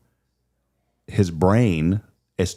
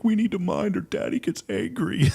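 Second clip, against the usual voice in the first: put-on much higher, small-child voice, earnest; little girl voice; 13 semitones higher than usual. Father imitating his daughter warning her brother about Daddy, in a high little-girl voice. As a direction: speak like a little girl explaining the rules. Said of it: "then she'll say things like".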